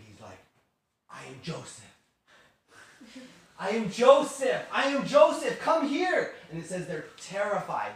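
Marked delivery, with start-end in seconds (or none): 1.1-1.9 low, soft confession; hushed, grave disclosure; quiet revelation. Retold or imitated. Joseph's first, quiet revelation. 3.6-6.3 high, loud, drawn-out proclamation; impassioned acted outburst; shouted revelation. Joseph shouts who he is.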